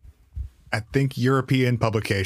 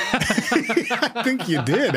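laughing; 12 semitones higher, much slower than usual. Real, not acted. Laughing reply.

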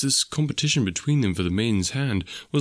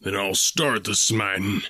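furious exclamation; slower than usual. A narrator's reading. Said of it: "exclaimed Ferrier furiously".

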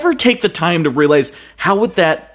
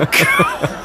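high laughing cry; 3 semitones higher than usual. Real, not acted. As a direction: burst out laughing on God. Laughing at joke.

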